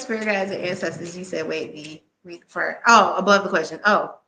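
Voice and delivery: ordinary delivery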